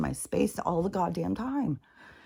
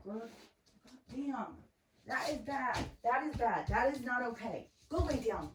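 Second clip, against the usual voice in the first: higher, firm dog-scolding voice; stern owner telling off a puppy; dog owner scolding a puppy that peed on the rug; 7 semitones higher, 8 dB quieter than usual. Real, not acted.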